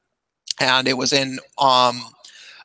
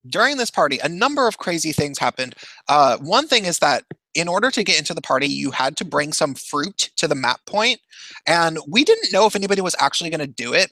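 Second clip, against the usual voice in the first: ordinary talk, nothing marked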